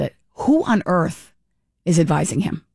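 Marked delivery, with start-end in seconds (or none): none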